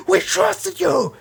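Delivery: high, drawn-out accusing yell; mock betrayed cry. Immortal confronting Omni-Man as he attacks, mock yell.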